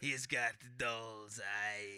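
very slow, low sailor impression; put-on ominous sailor voice; Quint. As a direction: stretch the words out like an old seaman. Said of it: "you keep talking like that".

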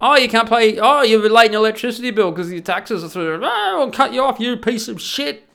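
high, loud mocking tirade; mock scolding. Government threatens someone late on their electricity bill, in high mocking scorn. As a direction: scornfully mimic a heartless bill collector.

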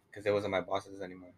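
ordinary talk, nothing marked

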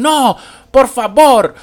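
put-on high, slow, loud American-accented Spanish "No, por favor"; gringo Spanish accent. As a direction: imitate an American actress straining at Spanish, drawn out and loud. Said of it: "es un español muy gringo".

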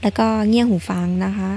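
usual speaking voice

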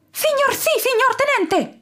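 very high, crisp proud salute; proud soldier. A narrator's reading. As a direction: snap off the answer like a proud cadet. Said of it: "rispose fiero il gabbiano capitano". (risposta fiera e acutissima)

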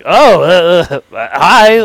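loud, high-pitched flustered greeting; caught off guard. Retold or imitated. Retelling three German people popping up on screen, caught off guard. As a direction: act out a startled, stumbling hello. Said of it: "I'm completely caught off guard".

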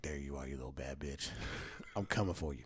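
low, hushed gloat at the fridge; gloating whisper. Retold or imitated. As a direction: creep up on the wings, murmuring greedily. Gloating to leftover wings in fridge.

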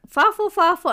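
put-on high, loud little-kid "Fawful, fawful."; toddler asking for waffle. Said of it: "she'll be like".

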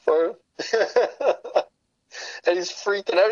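ordinary talk

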